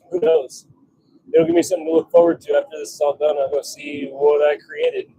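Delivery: normal talking voice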